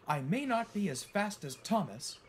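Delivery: high-pitched, indignant retort; fuming. A narrator's reading. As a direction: stand up for yourself, piqued. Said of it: "fumed Mavis".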